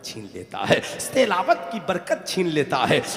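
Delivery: loud sermon building to a high shout; forceful, swelling preacher's cadence; impassioned preaching. Real, not acted. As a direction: drive each warning home, rising to a climax.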